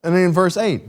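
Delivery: ordinary talk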